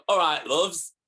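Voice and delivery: a light, playful Yorkshire "All right, loves"; Yorkshire accent. Pretend. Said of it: "I should actually talk like that".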